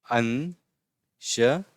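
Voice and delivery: "अंशतः" stretched out with long pauses between syllables; very slow syllables. Shown as a demonstration. Teacher repeating "अंशतः" for pupils, very slow syllables. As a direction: isolate each syllable of "अंशतः".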